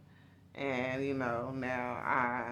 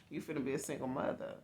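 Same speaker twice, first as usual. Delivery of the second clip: ordinary delivery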